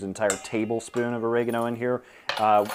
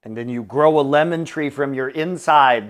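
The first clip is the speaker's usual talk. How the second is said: loud, raised mock-ominous voice; mock warning; 4 semitones higher, 8 dB louder than usual. Warning a friend about swallowed lemon seeds, mock warning. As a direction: play up the danger, louder and higher.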